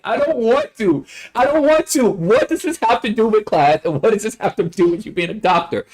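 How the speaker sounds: high-pitched mock-desperate complaint; exasperated protest. Student replaying their silent reaction to the teacher's tangent, exasperated.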